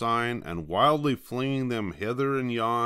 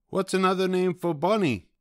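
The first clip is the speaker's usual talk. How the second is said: higher, sly taunting question; knowing tease; 10 semitones higher than usual. Acted. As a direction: ask like you know the joke. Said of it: "they asked knowingly".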